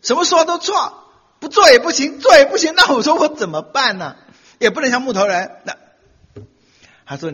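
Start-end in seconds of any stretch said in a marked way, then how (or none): 0.0-5.5 high, loud whining plea; mock exasperated husband, higher and louder; complaining husband. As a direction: whine it like a cornered husband.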